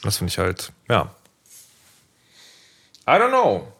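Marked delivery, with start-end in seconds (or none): 3.1-3.7 tossed-off I don't know; shrug. Real, not acted. Switches to English, shrugging. (ratlos hoch gesprochen)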